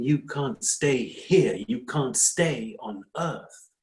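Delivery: slow, deliberate, spelling it out; slow and firm. Acted. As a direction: lay it down slowly so it sinks in. Firmly spelling out bad news.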